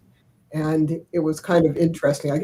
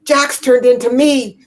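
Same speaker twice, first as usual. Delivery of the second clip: higher, louder despairing son; son's lament; 8 semitones higher, 5 dB louder than usual. Retold or imitated. Grandmother imitating her son on the phone, higher and louder. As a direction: play the son's comic despair.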